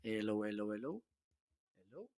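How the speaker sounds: very faint, level test hellos; distant, barely audible hellos; gain turned down. Shown as a demonstration. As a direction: test a mic fading to almost nothing. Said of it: "now I'm gonna decrease the gain".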